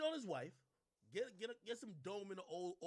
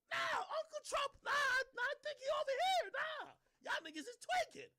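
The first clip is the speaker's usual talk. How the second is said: much higher mock shout of disbelief; mock incredulous voice; 4 dB louder than usual. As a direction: squeal it like you can't believe them.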